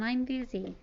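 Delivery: ordinary delivery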